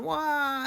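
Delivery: a quick, high, teasing fake wail; mock wah cry. Teacher teasing the moping character with a mock wah cry. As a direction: whimper "wah" like a spoiled kid, in jest.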